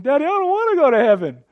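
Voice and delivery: high, loud child's wail imitation; crying little girl. Daughter crying to dad about heaven, high child wail. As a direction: play the four-year-old in tears. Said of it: "she starts crying".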